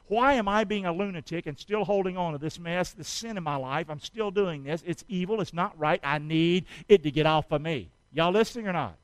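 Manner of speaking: ordinary talk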